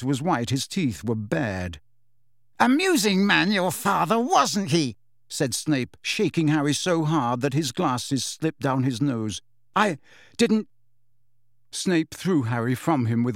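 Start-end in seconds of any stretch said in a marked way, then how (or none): 2.6-5.0 raised, high-pitched bitter mockery; enraged sarcasm. A narrator's reading. Snape shaking Harry, angry sarcasm. 9.7-10.7 high, halting denial; frightened protest. A narrator's reading. Harry shaken by Snape, frightened denial.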